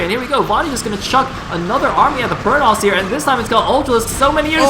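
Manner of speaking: rising, urgent esports commentary; excited play-by-play. Real, not acted. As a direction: build toward the big clash. Live play-by-play, battle starting.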